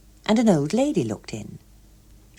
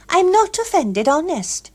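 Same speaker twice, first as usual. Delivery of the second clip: put-on brisk young woman; 12 semitones higher, 4 dB louder than usual. A narrator's reading. Gloria reassuring him quickly.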